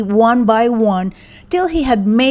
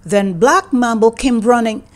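ordinary delivery

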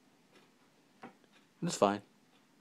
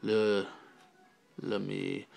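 ordinary talk, nothing marked

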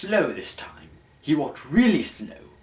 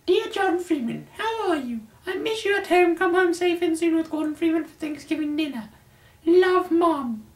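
much higher falsetto mom voice; mother's letter voice. Acted. Narrator voicing the mom's email in shrill falsetto. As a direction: squeak it out like a doting mother. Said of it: "opened up the emails and read them".

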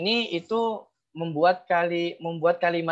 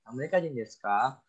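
"desu ka" lifted at the end; teacher's model of "Amerika jin desu ka?" with final rise; rising question intonation; 4 dB quieter than usual. Shown as a demonstration. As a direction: let "ka" climb as a question.

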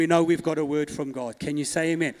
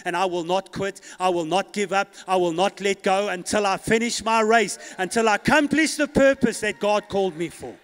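from level to louder declaration; preacher's vow growing louder; building vow; 3 semitones higher, 7 dB louder than usual. Retold or imitated. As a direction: swell into a loud vow. Retold vow builds to shout.

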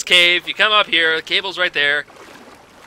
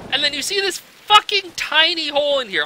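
ordinary talk, nothing marked

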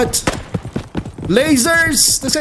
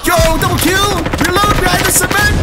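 high-pitched hyped gamer call; high, urgent excitement; excited battle call; 8 semitones higher than usual. Real, not acted. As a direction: call out over a firefight. Excited calls during firefight.

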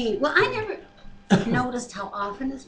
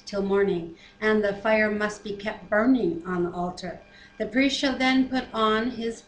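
scripture reading. Acted. Reads scripture aloud.

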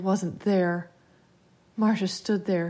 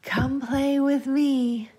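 high, very slow, singsong invitation; eerie child beckoning; luring child voice; 6 semitones higher, 4 dB louder, much slower than usual. A narrator's reading. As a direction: draw it out high to lure a playmate. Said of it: "the voice said".

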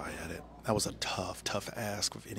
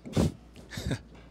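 whispered laugh. Real, not acted. Laugh after whispered joke.

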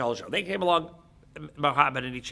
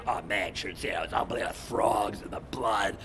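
low, hushed acted amazement; softer, lower voice of someone impressed; awed witness; 8 semitones lower, 4 dB quieter than usual. People recalling the plagues in awe.

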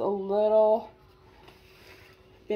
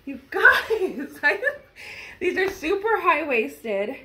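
laughing excitedly; 6 semitones higher, 6 dB louder, slower than usual. Real, not acted. Excited about high-waisted jeans.

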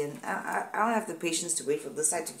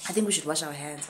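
mock serious whisper; 2 semitones lower, 5 dB louder, faster than usual. Real, not acted. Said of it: "This is very serious".

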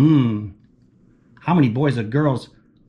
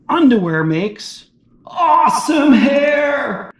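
slow, high, loud proclamation; excited shout; 10 semitones higher, 5 dB louder, slower than usual. A narrator's reading. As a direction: cry it out like big news. Reading the page aloud.

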